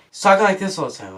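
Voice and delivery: lightly mocked emotionless actor; mild impression of a wooden actor; bland actor. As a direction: imitate a bland, unemotional line reading. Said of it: "He was completely bland".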